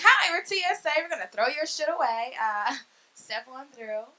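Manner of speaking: cheery put-on airport agent voice; mock TSA agent. Comic mocking TSA agents, breezy put-on voice. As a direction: play a breezy agent tossing your stuff. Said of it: "They're like".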